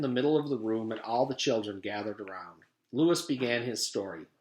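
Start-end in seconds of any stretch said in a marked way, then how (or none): none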